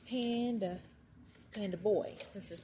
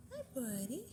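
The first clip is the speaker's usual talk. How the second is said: softer pet voice; 4 semitones higher, 5 dB quieter than usual. Real, not acted. Greeting a rabbit softer.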